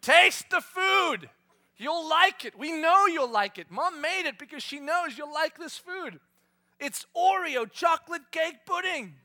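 high, loud, slow sing-song coaxing; coaxing parent. Retold or imitated. Parent to picky child, high loud coaxing. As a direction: sell the pudding to a stubborn kid.

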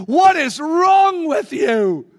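slow, loud, exasperated demand; fed-up man shouting at the women. Acted. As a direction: bellow it at the babbling women. Disciple confronting babbling women.

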